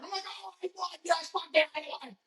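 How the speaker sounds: high, mocking gleeful cheer; mock the winner's glee; a hammed-up victory cheer; gloating rival.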